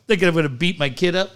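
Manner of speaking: normal talking voice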